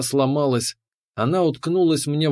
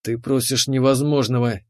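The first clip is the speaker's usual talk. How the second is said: ordinary delivery, nothing marked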